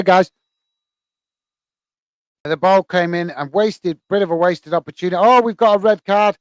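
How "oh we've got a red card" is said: sudden lift at the red card; surprised. Real, not acted. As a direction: caught off guard, voice jumps up. Red card shown during live match.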